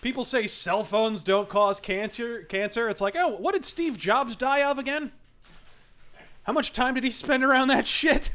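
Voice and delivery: lightly imitating a ranting comic; mock rant, sarcastic and loud; Nick DiPaolo. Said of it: "one of my favorite Nick DiPaolo bits".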